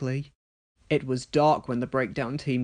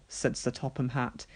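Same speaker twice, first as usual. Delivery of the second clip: ordinary delivery, nothing marked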